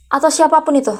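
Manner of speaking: normal talking voice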